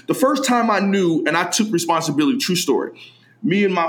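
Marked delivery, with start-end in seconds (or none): none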